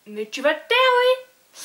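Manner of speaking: sudden high, loud scolding burst; exasperated shout. Real, not acted. Vlogger interrupts herself to silence someone, exasperated shout. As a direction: snap it out, rising sharply on oui.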